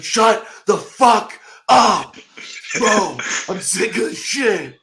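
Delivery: high, loud, slow furious snap; angry snap. Retold or imitated. Teammate snapping at Luke on the plane, angry shout. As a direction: bark it back, fed up. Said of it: "he just snaps at me".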